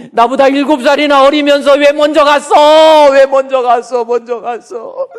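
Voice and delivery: loud, high mock sobbing lament; imitated wailing. Imitating widowed father at the columbarium, loud weeping wail. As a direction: wail it out, fading toward the end. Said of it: "펑펑 우는 거예요".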